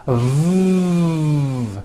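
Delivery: V as one long sustained buzz; voiced V sound. Shown as a demonstration. Teacher demonstrating the V sound, long voiced V buzz. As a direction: hold a buzzing V, no vowel. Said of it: "is voiced and sounds like this".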